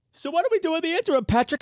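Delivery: mock impatient listener.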